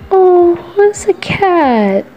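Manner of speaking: high, slow delighted gasp of a line; surprised. Acted. Listener finds kitten, high and amazed. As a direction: discover the kitten with wonder.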